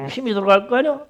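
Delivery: ordinary talk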